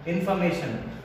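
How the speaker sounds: usual speaking voice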